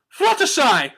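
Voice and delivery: high, loud, theatrical call; dramatic declaration. A narrator's reading. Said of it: "Rarity declared".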